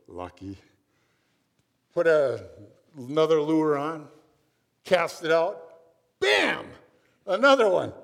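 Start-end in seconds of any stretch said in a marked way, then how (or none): 0.1-0.6 grudging mutter. Retold or imitated. Grudging thought after guide's catch. 6.2-7.9 excited exclamation. Retold or imitated. Guide hooks another fish.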